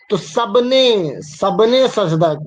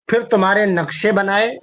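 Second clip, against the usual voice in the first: ordinary talk, nothing marked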